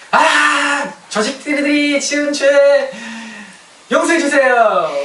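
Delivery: high, loud, drawn-out plea; mock wailing prayer. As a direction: cry out each phrase slowly in a hammed-up prayer. Parodies an accusing prayer of repentance. (과장되게 부르짖는 기도)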